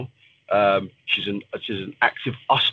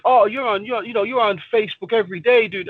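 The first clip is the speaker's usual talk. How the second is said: higher-pitched imitation of complainers; mock nagging; 10 semitones higher than usual. People telling the fighter he's always on Facebook, mock nagging. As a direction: mimic people pestering you, pitched up.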